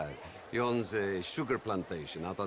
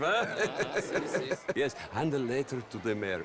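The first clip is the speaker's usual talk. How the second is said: ordinary delivery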